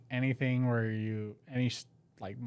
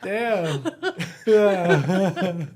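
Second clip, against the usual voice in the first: laughing; 7 semitones higher, 8 dB louder than usual. Real, not acted. Laughing after dog story.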